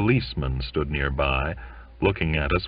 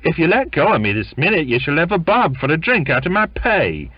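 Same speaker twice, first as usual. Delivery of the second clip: high, loud, fast good-natured bargaining; jolly bribe to let him go; 11 semitones higher, 6 dB louder, faster than usual. Acted. Son bargains good-naturedly with his clinging mother, high and loud. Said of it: "heard him say again good-naturedly".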